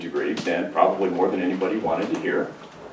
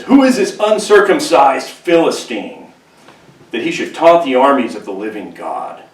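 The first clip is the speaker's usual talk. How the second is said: fired-up indignation; 4 semitones higher, 6 dB louder than usual. Retold or imitated. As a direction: call out the giant with fire. David fired up at Goliath.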